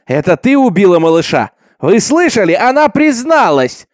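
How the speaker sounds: high, loud triumphant cry; accusing shout. A narrator's reading. Accuser announces the girl's confession to the mob, shouting.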